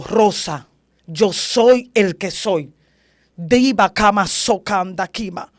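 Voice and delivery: loud, slow divine proclamation; imitating God speaking right into her ear; voice of God, like a microphone at the ear. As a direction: declare it slowly and loudly, with authority. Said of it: "me habló como cuando te pegan un micrófono al oído".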